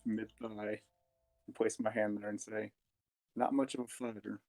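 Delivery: ordinary talk, nothing marked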